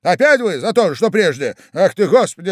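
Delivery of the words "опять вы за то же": ordinary delivery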